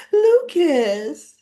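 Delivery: high, loud whiny pout; mock Carly sulking about her brother; pouty whine. Imitating Carly pouting to Jack, high whiny voice. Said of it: "That's why I gotta do the pouty face".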